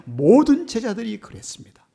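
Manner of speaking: high, loud proclaiming; emphatic preaching. Real, not acted. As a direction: declare it to the whole church. Loud then soft repeat. (높고 크게 외치듯)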